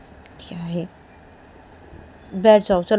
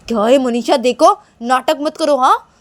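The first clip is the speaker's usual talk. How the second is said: loud, raised-pitch warning; getting angry; 4 semitones higher, 7 dB louder than usual. Acted. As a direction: scold crossly, losing patience. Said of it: "मुझे ना बहुत गुस्सा हो रहा है". (गुस्से में ऊँची आवाज़)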